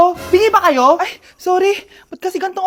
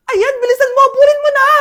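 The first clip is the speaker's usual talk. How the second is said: cheering at TV; 9 semitones higher, 4 dB louder than usual. Acted. Mother cheering TV show.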